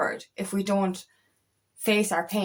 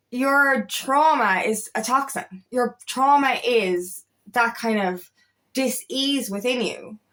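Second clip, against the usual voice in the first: slow, weighty, every word placed; slow emphasis; slower than usual. Real, not acted. Coach stressing her point to viewers, slow and deliberate. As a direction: spell it out slowly for the viewer.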